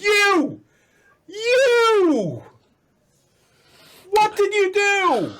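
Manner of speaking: very high accusing wail; hammed-up high scream, words drawn out; screaming woman. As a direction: shriek it in mock horror at the culprit. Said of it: "screaming at the top of her lungs".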